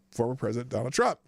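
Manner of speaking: normal talking voice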